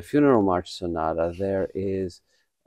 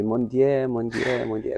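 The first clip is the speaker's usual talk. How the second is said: Mon Dieu said the Polish way, mon Dieu repeated; Polish-accented French, Mon Dieu three times; 3 semitones higher than usual. Shown as a demonstration. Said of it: "but the way a Polish would say".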